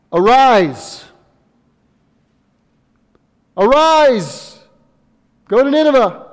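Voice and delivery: high, loud divine command; booming command. Acted. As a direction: call it out like God commanding. God's command quoted, shouted.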